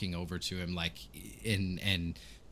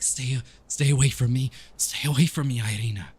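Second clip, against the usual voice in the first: higher, much louder cry to keep away; desperate warning; 5 semitones higher, 8 dB louder than usual. Acted. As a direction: push her off with your voice. GM acts Toru warding off Irina.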